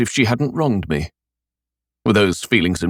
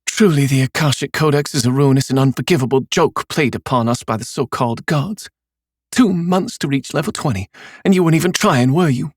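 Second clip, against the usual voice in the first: bitter; 7 semitones higher than usual. Acted. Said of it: "Jamie laughed bitterly".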